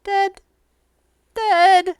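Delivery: small, high creature voice; mournful single words, high-pitched; grieving little animal; sad monkey. Acted. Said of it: "looks very sad".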